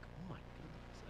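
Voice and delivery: slow, whispered aside; hushed shock. Retold or imitated. Child overhearing the call, hushed and shocked. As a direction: breathe it out under your breath.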